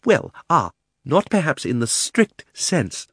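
stammering. A narrator's reading. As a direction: stumble over it, flustered. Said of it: "stammered Uncle Andrew".

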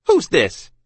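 put-on sharp, high, loud snap at the girl; snapped out. A narrator's reading. Captain's wife demands who the stranger is, snapping. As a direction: bite the question off. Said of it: "she snapped out".

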